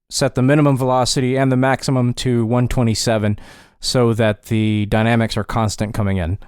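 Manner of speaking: normal talking voice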